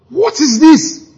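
slow, high, wondering "What is this?"; awed question, drawn out high; marveling. Retold or imitated. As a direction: let the wonder stretch it out. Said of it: "Just like he marveled".

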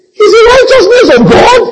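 loud, very high mock wail; mock crying. Sister protesting her father's salvation to her pastor, mock crying. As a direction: wail in disbelief, like a tearful complainer. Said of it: "she came and she's crying".

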